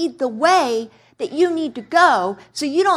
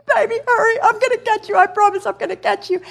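much higher coaxing mother voice; coaxing mom; 10 semitones higher, faster than usual. Retold or imitated. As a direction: sweetly urge the toddler to jump. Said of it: "I'm just like".

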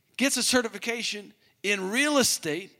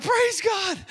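very high, loud praise God; jubilant shout; 13 semitones higher, 3 dB louder than usual. Retold or imitated. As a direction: cry it out in joy. Matching host's joy.

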